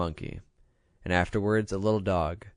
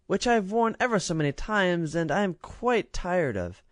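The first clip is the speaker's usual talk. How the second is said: spoiled princess; 9 semitones higher than usual. A narrator's reading. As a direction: toss away old frocks lightly. Princess continues.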